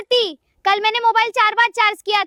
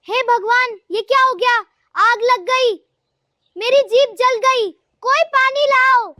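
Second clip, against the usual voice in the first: panicked outcry; 5 semitones higher than usual. Acted. Mother-in-law's tongue burns from chilli tea, panicked outcry. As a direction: cry for help with a burning mouth.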